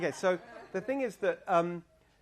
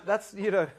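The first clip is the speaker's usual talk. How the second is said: ordinary talk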